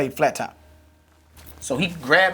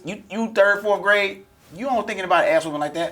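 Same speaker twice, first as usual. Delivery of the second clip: put-on raised, high-pitched cocky kid comeback; mock defiant reply; 8 semitones higher, 3 dB louder than usual. Acting out LaRon's defiant reply.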